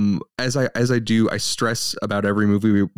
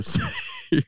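laughs in the middle of the words; laughing; 4 dB quieter than usual. Real, not acted. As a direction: break up laughing mid-line. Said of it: "I take a lot of hits".

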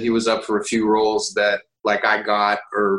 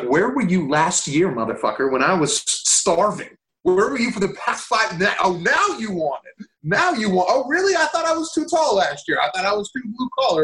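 high, incredulous retold tirade; indignant mock rant; 8 semitones higher than usual. Actor ranting at casting people who ignored him, indignant. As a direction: climb higher as the grievance builds.

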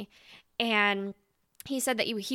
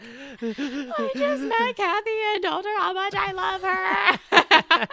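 high, slow, laughing while half crying; laughing through tears; 11 semitones higher, 8 dB louder, slower than usual. Retold or imitated. Telling her best friend she met her idol, laughing through tears. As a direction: gush it out between laughs and tears.

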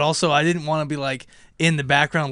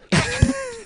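held-back laugh. Retold or imitated. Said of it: "just trying to hold it back".

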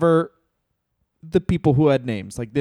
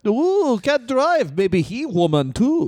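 much higher comic Russian voice; hammed-up Russian accent; mock Russian heel; 7 semitones higher, slower than usual. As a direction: jeer like a cartoon heel. Continues mock accent bit.

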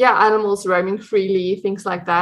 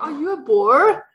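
higher insistent mock question; pushing the question higher; repeated Boer question; 6 semitones higher than usual. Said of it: "he's like".

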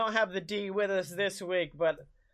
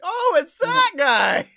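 loud, high, slow exclamation; excited recognition; 12 semitones higher, 10 dB louder, slower than usual. Retold or imitated. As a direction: shout it out like you just recognized him.

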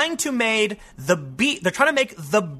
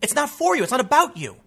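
quoted celebrity; 5 semitones higher, 4 dB louder than usual. Retold or imitated. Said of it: "And he goes".

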